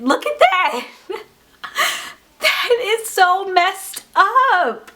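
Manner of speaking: high, slow, laughing through the words; laughing in disbelief. Real, not acted. Vlogger showing botched nails to viewers, laughing in disbelief. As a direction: crack up at how bad it is. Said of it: "I'm laughing because I can't believe".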